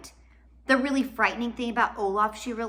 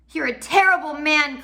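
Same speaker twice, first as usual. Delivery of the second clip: loud, high spitting contempt; spat out; 7 semitones higher, 9 dB louder than usual. Acted. Boy spitting his anger at the villain, loud. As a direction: spit the insult at him. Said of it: "Klaus spat out".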